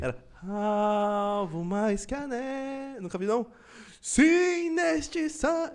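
slow, shivery mock gospel singing; hammed-up trembling worship croon. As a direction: sing it like a singer freezing cold. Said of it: "O meu é o [name] com frio".

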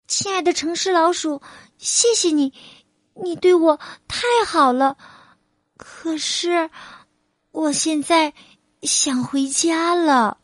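a gentle mouse thanking a friend; country mouse farewell. A narrator's reading. Country mouse telling city mouse it wants home, high polite mouse voice.

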